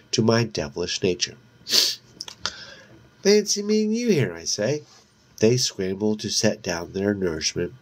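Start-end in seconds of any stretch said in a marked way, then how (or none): 3.2-4.3 teasing. A narrator's reading. Devilish greeting to cops.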